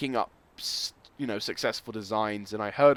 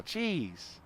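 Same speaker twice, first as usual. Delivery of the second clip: usual speaking voice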